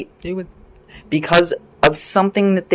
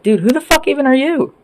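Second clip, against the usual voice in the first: much higher, mock-baffled question; incredulous dismissal; 12 semitones higher than usual. Answering those who thought we were bothered, high incredulous dismissal. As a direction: pitch it way up, unbothered and scornful. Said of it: "they thought that that meant that we were bothered. We're like".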